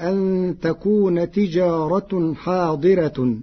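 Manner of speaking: تجارة حاضرة ending in u, tijaratun hadiratun; برفع التاء; Warsh reading with damma. Shown as a demonstration. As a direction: recite تجارة حاضرة with raf. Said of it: "برفع التاء فيهما".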